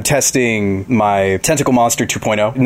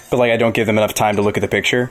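ordinary delivery

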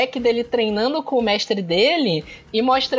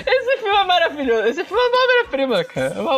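laughing; 9 semitones higher than usual. Real, not acted. Gushing about favourite film.

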